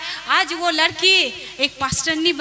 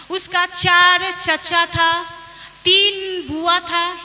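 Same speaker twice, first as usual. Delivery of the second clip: slow emphatic listing; much slower than usual. Real, not acted. Pastor stressing family size.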